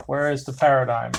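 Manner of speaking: normal talking voice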